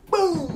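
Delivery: loud, high shouted boom sound effect. Retold or imitated. Friend retelling the knockout punch, shouted boom.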